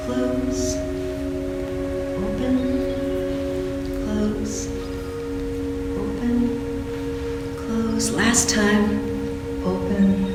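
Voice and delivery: quiet, drawn-out breath cues; softly pace the class through each move; soft cueing. Real, not acted. Soft breath cues during boat.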